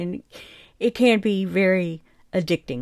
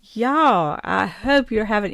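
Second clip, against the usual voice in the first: a Southern y'all, drawn out and called out loud; southern drawl; 3 semitones higher, 4 dB louder, slower than usual. Real, not acted. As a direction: let the South Georgia drawl slip out on y'all. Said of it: "that southern drawl came out right there".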